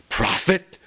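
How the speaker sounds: high loud call; loud accusation. Acted. Lover shouting at the raven. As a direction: hurl the name at the bird. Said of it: "said I".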